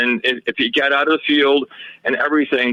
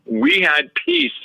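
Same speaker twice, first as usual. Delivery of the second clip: drawn out, pitched up high; slow, lifted, savoring the word peace; slow emphatic; slower than usual. Real, not acted. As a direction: stretch it out like a sigh of relief. Dramatic pause before relief.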